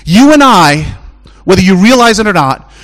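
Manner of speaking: high, loud pulpit preaching; rising preacher's call; full-force preaching. Acted. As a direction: preach it out to the whole church.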